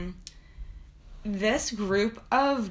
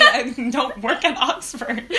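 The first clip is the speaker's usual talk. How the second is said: laughing; 4 semitones higher, 7 dB louder, slower than usual. Retold or imitated. Retold sassy reply, laughing.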